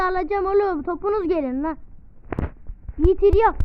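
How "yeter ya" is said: exasperated outburst. Real, not acted. Fed up in fight.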